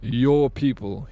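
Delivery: "Your" stressed, slow and drawn out; quoting mockingly. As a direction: lean hard on "Your", slowly. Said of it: "he said".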